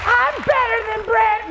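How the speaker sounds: high, slow put-on woman's voice; envious audience member. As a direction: protest like a jealous listener. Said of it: "she's like".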